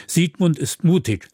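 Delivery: stubbornly maintaining it; insistent. A narrator's reading. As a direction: stand your ground, voice lifted. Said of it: "beharrte Thomas".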